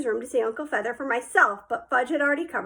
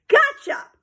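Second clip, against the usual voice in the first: high loud gotcha cry; triumphant shout; 8 dB louder than usual. A narrator's reading. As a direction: pounce with a loud yell. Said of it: "I called".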